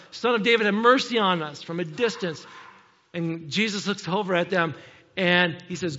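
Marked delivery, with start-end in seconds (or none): 0.1-1.6 loud, raised plea from afar; retold cry for help, high and loud. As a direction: call out for mercy across a distance. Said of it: "they cried out".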